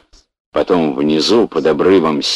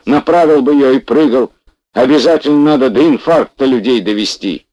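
loud, much higher scolding shout; furious yell; 10 semitones higher, 6 dB louder than usual. Acted. Fat man yells at Pashka after the crash, furious shout. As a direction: bawl him out, terrified and furious. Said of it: "заорал".

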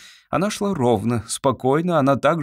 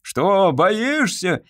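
taunting shout; 7 semitones higher, much slower than usual. A narrator's reading. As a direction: challenge him with a shout. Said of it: "крикнул Цыбин".

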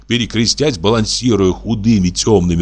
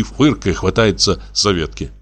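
ordinary delivery

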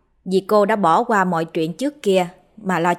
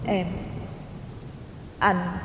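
distant ghostly voice; 5 dB quieter than usual. A narrator's reading. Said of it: "nói với giọng xa xăm".

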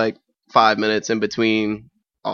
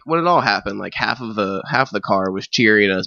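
usual speaking voice

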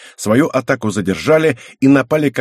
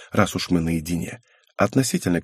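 ordinary delivery, nothing marked

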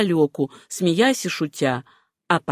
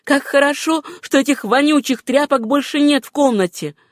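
high-pitched, glad relief; higher woman's voice, delighted; relieved exclamation; 7 semitones higher than usual. A narrator's reading. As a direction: exclaim it high, rid of something foul. Etta relieved rags are gone.

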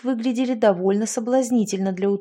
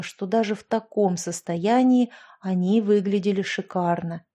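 ordinary delivery

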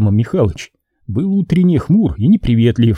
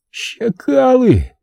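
цедит сквозь зубы, протяжно и высоко; юный мститель злобно выдавливает ругательство; процедил сквозь зубы; 13 semitones higher, much slower than usual. Acted. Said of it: "процедил он сквозь зубы". (раненый мальчишка цедит сквозь зубы, медленно и высоко)

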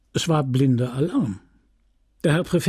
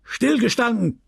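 high barked order; barked drill commands; 9 semitones higher than usual. A narrator's reading. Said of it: "brüllte der Oberst". (hoch gebellter Befehl)

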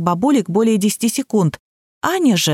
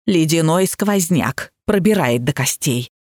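ordinary delivery, nothing marked

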